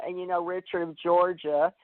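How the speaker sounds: usual speaking voice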